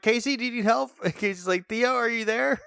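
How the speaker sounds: high, straining mock call; mock bad phone call. Cohost acts out Theo and Casey's broken call, high and straining. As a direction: call out over a broken line. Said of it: "Theo's like".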